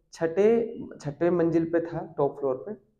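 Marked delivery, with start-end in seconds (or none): none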